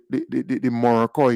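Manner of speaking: ordinary talk, nothing marked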